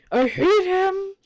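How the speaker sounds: high, drawn-out, strained outburst; exasperated hatred. Real, not acted. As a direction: squeeze it out in sheer frustration. Venting hatred of the character.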